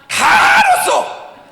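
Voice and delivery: slow, high laugh-shout "다 알았어". Real, not acted. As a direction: burst out laughing as you say it. Mocking all-agree answers. (웃음 섞인 외침)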